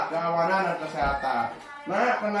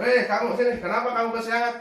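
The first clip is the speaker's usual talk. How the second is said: higher, louder, friendly call; friendly joking tone; 6 semitones higher, 3 dB louder, faster than usual. Shown as a demonstration. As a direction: greet the late pupil like a buddy. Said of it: "nada suaranya ramah, akrab, dan bercanda". (nada ramah dan bercanda)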